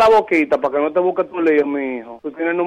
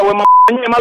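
angry shout; 3 semitones higher, 4 dB louder, much slower than usual. Real, not acted. Victim still yelling.

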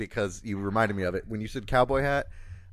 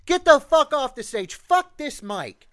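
much higher, louder furious Boston heckle; Boston townie yell; 12 semitones higher, 7 dB louder than usual. Retold or imitated. Townies heckling the country singer, a loud Boston yell. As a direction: roar it at the stage like an angry local. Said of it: "in that voice".

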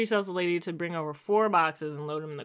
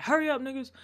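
higher-pitched mock holler; imitated impatient call; 8 semitones higher than usual. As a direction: call through a window to hurry them. Man calling while banging on window.

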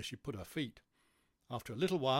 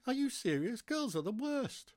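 disgusted disbelief; 12 semitones higher than usual. Acted. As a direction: complain in a high, appalled character voice. Bee scorns girls.

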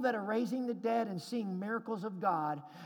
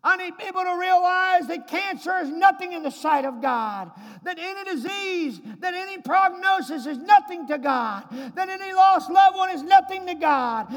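loud, high shouted preaching; preaching at full force; 10 semitones higher, 14 dB louder than usual. Acted. Preacher shouting to the church at the climax. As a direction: build to a thunderous peak.

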